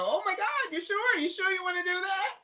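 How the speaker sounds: high, disbelieving "you sure?"; incredulous. Retold or imitated. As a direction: play someone stunned by the offer. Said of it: "where I would go".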